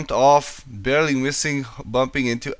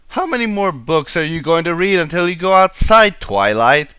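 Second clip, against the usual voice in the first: higher, louder little dragon; a young dragon voice, raised and higher; Spike voiced; 5 semitones higher, 5 dB louder than usual. A narrator's reading. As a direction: nudge Twilight to go outside. Spike nagging Twilight.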